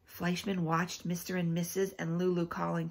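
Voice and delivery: usual speaking voice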